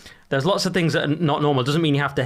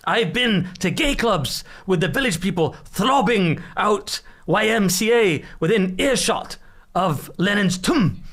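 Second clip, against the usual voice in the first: imitating Galloway; 6 semitones higher, much slower than usual. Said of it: "he's there on the clip going".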